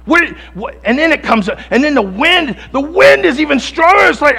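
loud, high impassioned sermon climax; fiery preacher in full flight; preaching at full force. Acted. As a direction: preach it out over the storm.

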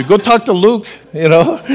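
ordinary delivery

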